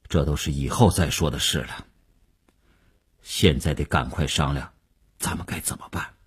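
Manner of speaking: low, soft, pressing practicality; composed Wu, urgent. A narrator's reading. Wu calming Mei's outburst, low and pressing. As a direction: hush the fuss and get down to business.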